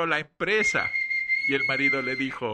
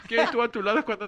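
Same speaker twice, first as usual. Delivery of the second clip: laughing; 7 semitones higher, much faster than usual. Real, not acted. Repeating punchline while laughing.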